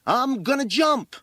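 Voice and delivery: high, loud yell from the roof; defiant boy's shout. A narrator's reading. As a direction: shout it down as a threat. Boy shouts threat from roof.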